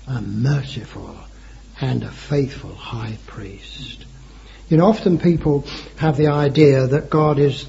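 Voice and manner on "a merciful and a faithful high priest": "merciful" set apart by pauses, low and soft; emphasis on "merciful". Shown as a demonstration. Preacher pointing the congregation to one word, emphasis on "merciful". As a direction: weigh "merciful" slowly and deliberately, voice dropped. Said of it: "I emphasize the word".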